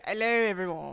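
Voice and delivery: a mock British hello to all; light British accent greeting. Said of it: "I just want to be British".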